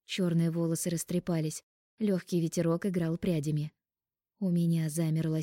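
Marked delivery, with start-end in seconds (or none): none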